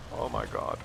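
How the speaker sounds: whispered mock teen gasp; hushed mock oh my God; mocking teen whine. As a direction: breathe it like a dramatic teen. Mocking kids' drama.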